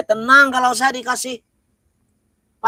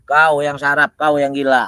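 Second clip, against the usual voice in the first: low possessed voice; 10 semitones lower than usual. Acted.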